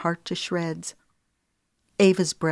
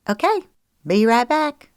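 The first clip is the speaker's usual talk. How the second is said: high, bright and louder; cheerful quick reply; higher chirpy Rochelle; 7 semitones higher, 5 dB louder than usual. Acted. Friend agreeing to fetch drinks, high and chirpy.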